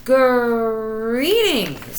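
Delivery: loud, big tiger voice. Acted. Tiger greeting the nervous little cats in a big loud voice. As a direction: boom it out like a great cat. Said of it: "said the tiger".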